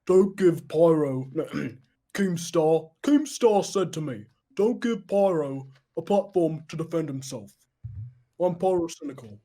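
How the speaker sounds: deliberate, slowed-down YouTuber parody; Pyrocynical impression. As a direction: stretch out the words in a put-on voice. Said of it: "I'm really good doing Pyro impression".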